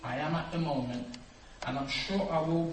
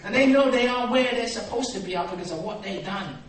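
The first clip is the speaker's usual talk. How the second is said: loud, high emphatic preaching; 8 semitones higher, 14 dB louder than usual. Real, not acted. Preacher telling congregation about prisoners, loud and high. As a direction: drive it home to the crowd.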